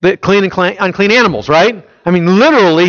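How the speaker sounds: usual speaking voice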